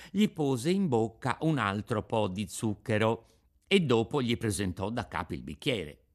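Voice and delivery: ordinary delivery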